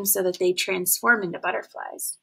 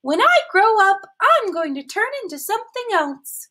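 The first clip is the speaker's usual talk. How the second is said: much higher, bragging caterpillar voice; proud caterpillar; 10 semitones higher, 4 dB louder than usual. A narrator's reading. Caterpillar boasting to the snail, high proud voice. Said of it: "she told the snail".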